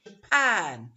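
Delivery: "Japan" with a loud, long "PAN"; weak "Ja", push "pan"; second-syllable stress. Shown as a demonstration. Teacher modelling country names, stress on the end. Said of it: "The same goes for".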